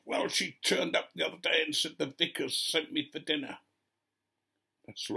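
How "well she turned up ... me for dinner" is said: imitated indignant complaint, pitched higher; fuming. Churchgoer explaining unwanted guest to vicar, mock-fuming. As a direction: complain in mock outrage, voice lifted. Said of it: "came up to me absolutely fuming".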